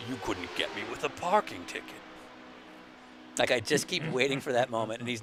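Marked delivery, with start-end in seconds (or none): 0.1-1.9 put-on lower, softer South African villain accent; South African villain impression. Mimicking the Lethal Weapon villain in a South African accent. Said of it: "sounds like the dude".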